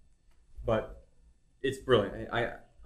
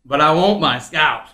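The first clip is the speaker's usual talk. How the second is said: loud, slow, drawling Southern movie-hero voice; bad Southern accent; 12 dB louder, slower than usual. Reluctant co-host finally doing the trailer line, loud Southern drawl. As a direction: belt it out in a hammed-up Southern drawl. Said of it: "do his voice".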